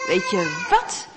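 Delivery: a hint of mother's voice, slow and high; mother soothing her boy, lightly voiced and slow. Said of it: "zegt moeder".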